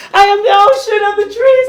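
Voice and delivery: high, loud inner voice rising up. Retold or imitated. Inner self waking up to the depressed speaker, exultant. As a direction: proclaim it with elated conviction. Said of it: "then the feeling inside me again".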